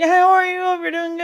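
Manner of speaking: put-on high, sugary girl voice; fake sweet. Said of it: "the girl was always like".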